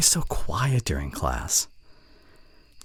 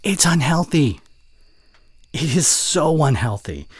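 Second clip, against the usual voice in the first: higher, louder disapproving scold; 10 semitones higher, 7 dB louder than usual. Acted. Friend nagging about unhealthy soda, disapproving. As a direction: nag her about the drink.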